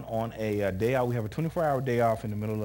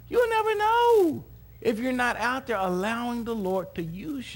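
raised, emphatic sermon delivery; preaching; 9 semitones higher, slower than usual. Real, not acted. Preacher urging listeners to let God use them, high emphatic preaching. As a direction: drive the point home to a congregation.

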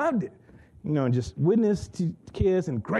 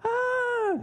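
put-on girl's cry. Girl freaking out at music.

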